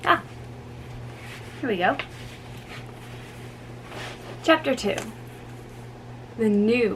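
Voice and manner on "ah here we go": ordinary delivery, nothing marked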